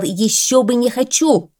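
«Ещё бы не хочу!» repeated sternly with stress on хочу; stern quote. A narrator's reading. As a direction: throw his own words back at him, severely. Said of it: "сурово посмотрел на него Белый".

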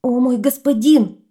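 high, loud desperate plea; pleading. Acted. Boar begs the dragon, loud frightened plea. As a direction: beg your master for mercy. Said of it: "взмолился кабан".

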